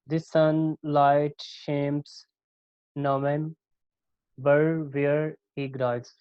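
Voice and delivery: very slow, measured line of verse; poem reading. Shown as a demonstration. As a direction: read it out beat by beat. (कविता की पंक्ति बहुत धीमे, ठहर-ठहर कर)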